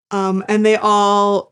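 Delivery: usual speaking voice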